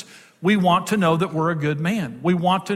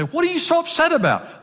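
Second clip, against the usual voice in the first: much higher, mock exasperated husband; 11 semitones higher than usual. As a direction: imitate yourself impatiently questioning your wife, pitched way up. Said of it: "Like that".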